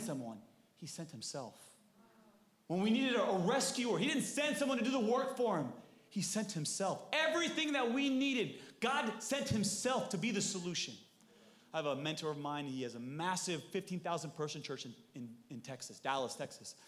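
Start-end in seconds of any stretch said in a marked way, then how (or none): 2.7-10.9 high, loud, driving sermon delivery; preaching at full force. Real, not acted. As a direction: preach it with rising fervor.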